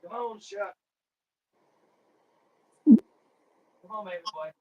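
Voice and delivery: soft cooing pet voice. Real, not acted. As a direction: coo softly to a puppy. Cooing to his dog.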